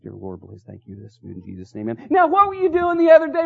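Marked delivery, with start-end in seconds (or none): 0.0-2.1 very low, barely audible mumbled prayer; mock hushed prayer. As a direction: imitate someone suddenly shy to pray aloud. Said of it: "I'm talking kinda loud. You're talking like this".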